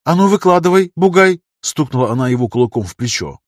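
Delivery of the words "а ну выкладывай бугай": high, loud bossy order; scolding girl, sharp and loud; demanding. Acted. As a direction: raise pitch and volume to demand answers. Girl confronts workman and thumps him.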